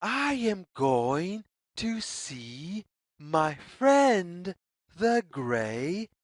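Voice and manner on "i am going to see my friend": ordinary delivery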